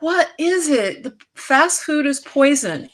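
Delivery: high, slow, indignant; exasperated retort. Retold or imitated. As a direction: say it like you can't believe the question. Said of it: "'cause I'm just like".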